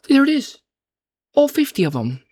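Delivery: high-pitched, hammed-up pointing-out; exaggerated sing-out to a buyer; mock exclamation. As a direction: exclaim it high, as if showing someone the obvious. Acts out pointing buyers to free flax.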